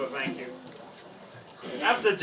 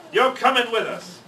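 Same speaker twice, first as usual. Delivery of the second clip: pirate order; 6 semitones higher, 5 dB louder than usual. Acted. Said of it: "Braid Beard told me".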